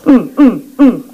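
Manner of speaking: high, short pleading groans; groaning prayer. Shown as a demonstration. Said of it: "이렇게 기도해요". (높은 음으로 짧게 신음하듯 기도)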